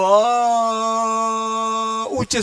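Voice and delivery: a long high sung अ; high held a. Shown as a demonstration. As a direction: hold अ high like a singer. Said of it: "जिस भी स्वर का उच्चारण ऊंचे स्वर में किया जाए".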